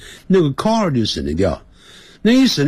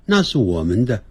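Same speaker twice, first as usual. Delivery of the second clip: normal talking voice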